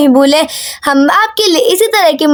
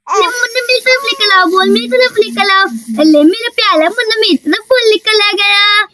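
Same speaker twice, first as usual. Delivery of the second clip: put-on childish baby-talk lisp; lisping little girl. Daisy plays with the baby.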